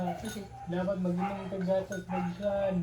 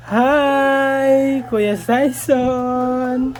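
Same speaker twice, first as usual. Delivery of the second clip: loud call to someone inside; calling from far away; 7 semitones higher, 15 dB louder, much slower than usual. Real, not acted. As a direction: call out across the yard. Calling at neighbor's door.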